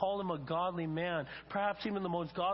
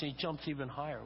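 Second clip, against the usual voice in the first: ordinary delivery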